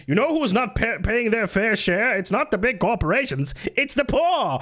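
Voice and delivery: high-pitched mock pundit voice; smug pundit imitation. Mimicking a pundit blaming the poor, hammed-up. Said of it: "one of them is like".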